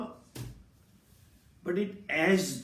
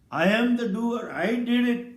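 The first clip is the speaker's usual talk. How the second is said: louder and higher, claiming the deed; proud boast; 4 semitones higher, 6 dB louder than usual. Shown as a demonstration. Teacher voicing the ego claiming credit, proud and louder. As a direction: puff up like the proud doer.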